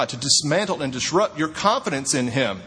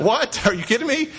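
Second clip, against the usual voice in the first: mock indignation; 6 semitones higher than usual. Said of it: "I'll act like I'm mad".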